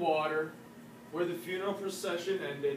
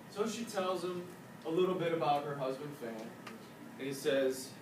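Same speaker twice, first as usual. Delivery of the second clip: ordinary delivery, nothing marked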